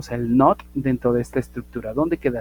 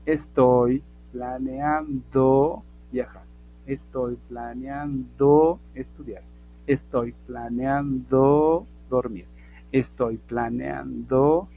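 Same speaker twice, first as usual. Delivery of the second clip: very slow, stretched "planeando"; slow model answers; 3 dB louder, much slower than usual. Shown as a demonstration. Teacher dictating the required answer, slow and drawn-out. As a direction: draw out each word, hanging on "planeando". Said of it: "La respuesta tiene que decir".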